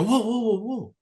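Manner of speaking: high, loud burst of alarm; retold startled whoa. Retold startled reaction to phone call.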